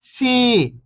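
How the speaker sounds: long, stretched "सी"; "सी" with a sustained long ee; drawn-out vowel. Shown as a demonstration. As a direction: hold the ई in "सी". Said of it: "बड़ी ई की मात्रा को खींच के पढ़ा जाता है".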